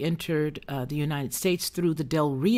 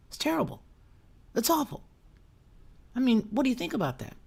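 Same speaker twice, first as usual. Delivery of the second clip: mock innocence; 5 semitones higher, 4 dB quieter, faster than usual. Mock innocent sarcastic questions.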